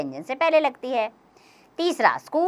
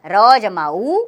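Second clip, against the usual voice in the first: ordinary talk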